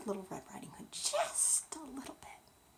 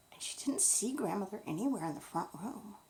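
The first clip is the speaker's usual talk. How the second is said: ordinary delivery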